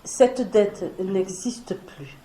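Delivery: slow and deliberate. Shown as a demonstration. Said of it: "Le vrai pardon".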